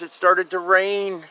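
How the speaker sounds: usual speaking voice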